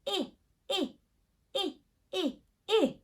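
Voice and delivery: "I" said as the short vowel of "in", clipped and repeated; short i sound. Shown as a demonstration. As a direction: say "I" as a short, clear /ɪ/ sound, three times. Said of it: "the sound it makes is I".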